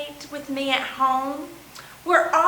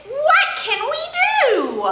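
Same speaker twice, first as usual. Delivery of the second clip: penguin voice; 12 semitones higher than usual. Acted. Penguin character speaks in rhyme.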